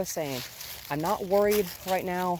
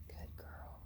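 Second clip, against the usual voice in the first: whispered praise; 18 dB quieter than usual. Real, not acted. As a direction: breathe the praise to calm the dog. Soothing the dog in whispers.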